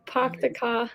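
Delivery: Boston accent, R dropped in "Park". Shown as a demonstration. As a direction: try a Boston "Park the car". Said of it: "in a Boston accent".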